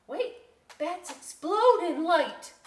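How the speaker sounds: loud, slow panicked warning; alarmed. Acted. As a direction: cry out in alarm. Ophelia alarmed.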